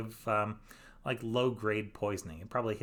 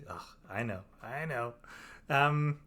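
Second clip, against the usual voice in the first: usual speaking voice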